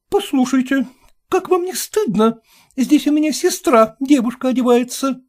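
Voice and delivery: high, reproachful protest; young widow scolding the landlord, indignant; indignant young woman. Acted. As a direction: shame the intruder. Hostess indignant.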